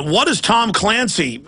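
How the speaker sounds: ordinary delivery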